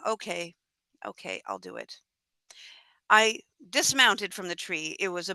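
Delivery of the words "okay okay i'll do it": low, soft resigned sigh; sigh, then quietly agree; reluctant giving in. Acted. Talking oneself into climbing down to the wolf, reluctantly resigned.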